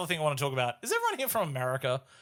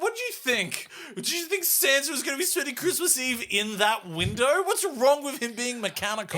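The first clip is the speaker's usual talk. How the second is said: much higher, mock-baffled scolding of the kid; incredulous retort; 8 semitones higher than usual. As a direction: needle him like he's being absurd. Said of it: "And I'm like".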